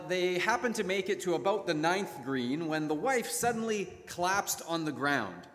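ordinary delivery

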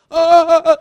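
sharp high panicked ah-ah-ahs; mock panicked twitching gasps. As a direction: stutter out frightened high gasps. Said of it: "twitching, like I said".

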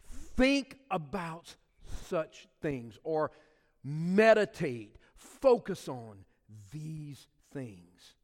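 drawn out, words spaced apart; slow down and let it sink in; slow urging. Acted. Pastor urging listeners to dwell on good things, slow. Preacher urges meditation slowly.

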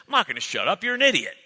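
a hint of a friend refusing to back off; firm, teasing insult; blunt friend. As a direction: friend insisting he won't shut up. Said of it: "That's how men talk".

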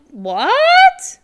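loud, high-pitched drawn-out "what?"; shocked, confused. Retold or imitated. Teacher reacting to a puzzling message, shocked and confused. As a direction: retell it as a big incredulous squeal. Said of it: "I was just so confused".

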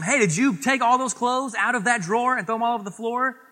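a parent confronting a child, mock-stern; stern parent question. Parent to child about the clothes, a high mock-stern question.

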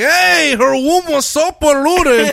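high, loud, drawn-out dad voice; dad impression. Son imitating his dad, loud high impression. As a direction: call it out slowly as a mock dad. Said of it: "My dad's more like".

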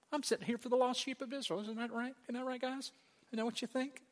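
high, soft mock-innocent asking; rhetorical questioning. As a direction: play Jesus prodding the disciples, high and soft. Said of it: "It's a rhetorical question".

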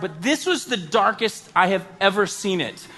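ordinary talk, nothing marked

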